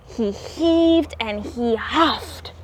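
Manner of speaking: plain reading voice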